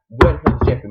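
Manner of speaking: loud retold demand; exasperated demand. Staff hunting for a missing man, exasperated. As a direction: punch every word out loudly.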